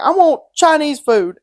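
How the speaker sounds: high, loud, slow demand; mock childish demand. Dad phoning his kid for food, demanding.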